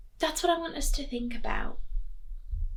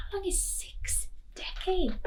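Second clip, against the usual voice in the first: breathy whispered question; 8 semitones higher, 7 dB quieter than usual. Real, not acted. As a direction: whisper it like a secret to the class. Teacher whispers question to class.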